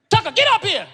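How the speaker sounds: loud, high coach bark from the sideline; coach yelling. Retold or imitated. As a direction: holler for Tucker like a coach. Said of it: "He said".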